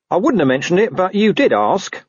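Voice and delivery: a hint of a grumbling street dog; talking dog. Gaspode the dog answers Carrot, lightly voiced scruffy mutt.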